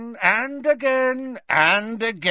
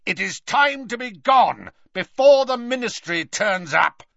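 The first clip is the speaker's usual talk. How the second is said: urgent raised command, higher; Snape; 7 semitones higher, 4 dB louder than usual. A narrator's reading. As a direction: call out an order to retreat. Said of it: "he shouted at the huge".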